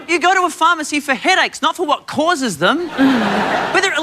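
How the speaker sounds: ordinary delivery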